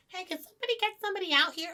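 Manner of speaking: high, rapid call; hurried high-pitched mock complaint; imitated complaining customer. Imitating drive-thru customers complaining.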